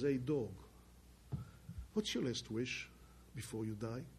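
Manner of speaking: ordinary talk, nothing marked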